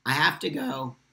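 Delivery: usual speaking voice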